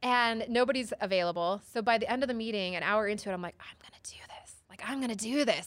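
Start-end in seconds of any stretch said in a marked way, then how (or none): 3.6-4.5 whispered to herself, barely voiced; small inner whisper. Telling the podcast host about her small inner voice, whispered. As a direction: whisper the resolve under your breath. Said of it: "this small little voice".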